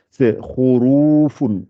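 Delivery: khurufun said slowly with a throaty kh; Arabic word demo. Shown as a demonstration. Teacher models the word for students, slow and throaty. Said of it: "Voilà, khurufun".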